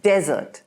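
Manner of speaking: desert with DE stressed; desert, first syllable strong. Shown as a demonstration. Teacher shows the noun desert, stressed on DE. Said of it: "the emphasis is on de".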